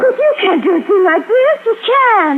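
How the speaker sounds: begging them to stop; desperate protest. Acted. Wife protests being kidnapped.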